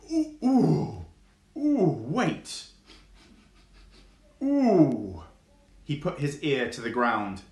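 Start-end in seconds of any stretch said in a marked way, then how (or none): 0.1-5.2 high, eager oohs of discovery; excited fox. Acted. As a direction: squeal with sudden interest. Fox hears worms.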